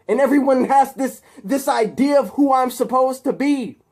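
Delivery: high, loud, desperate rant; agitated outburst. Acted. Harry confronting captive Peter about their friendship, agitated outburst. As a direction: build to a high, pleading shout.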